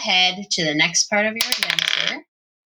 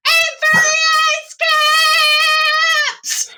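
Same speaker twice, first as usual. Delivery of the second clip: high, loud sung-out yell; Hermie yelling; 5 dB louder than usual. Acted. GM voices Hermie yelling to the sledders, high and loud. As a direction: belt it out like a cheering elf. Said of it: "you hear Hermie yell".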